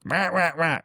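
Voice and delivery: high imitated bird squawks, three in a row; parrot squawk. Parrot squawking at a passerby, mock squawk. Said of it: "and the parrot goes".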